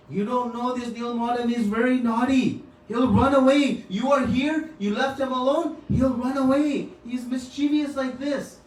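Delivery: worried he'll slip away; anxious scolding. Retold or imitated. Vishwasu warns the king Nilmadhav will run, high anxious fretting.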